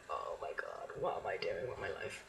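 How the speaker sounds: low, whispered mock despair. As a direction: breathe it out like all hope is gone. Retold despair after panic.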